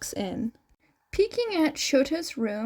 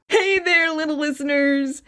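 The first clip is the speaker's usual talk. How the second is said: higher, louder radio-host hello; cheery DJ greeting; 3 semitones higher, 5 dB louder than usual. A narrator's reading. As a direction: greet them like an on-air host.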